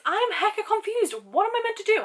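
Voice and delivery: ordinary delivery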